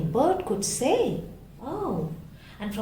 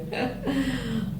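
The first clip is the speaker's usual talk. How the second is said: breathy hyena laugh; hyena cackle; 2 semitones higher, 5 dB quieter than usual. Acted. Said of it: "Hyena laughed and laughed".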